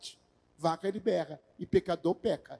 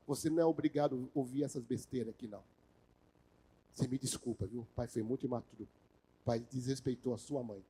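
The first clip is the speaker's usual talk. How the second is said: gentle apology to son; 6 semitones lower, 4 dB quieter than usual. Retold or imitated. Father apologizing to young son.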